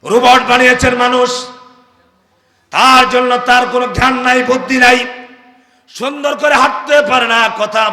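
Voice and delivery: loud, high preaching call; full-force sermon shout; fiery preaching. Acted. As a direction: preach it out to the crowd.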